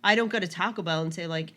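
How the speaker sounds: ordinary talk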